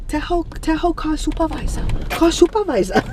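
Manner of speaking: a hint of an eager coworker whispering advice; imitating coworker. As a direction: egg her on in a mimicked coworker voice. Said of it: "Diana was like".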